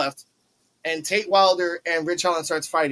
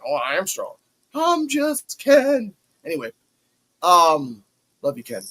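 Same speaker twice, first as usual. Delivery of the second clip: mock dude voice. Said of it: "talking like a dude".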